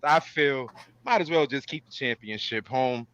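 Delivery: normal talking voice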